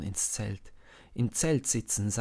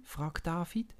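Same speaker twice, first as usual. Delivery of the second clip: ordinary delivery, nothing marked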